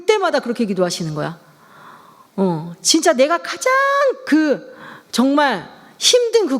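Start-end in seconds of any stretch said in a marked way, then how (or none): none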